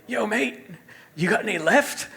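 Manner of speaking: high, loud imitated Australian voice; Australian accent. Friend from Melbourne asking for sneakers, Australian accent. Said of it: "that's pretty good with the accent".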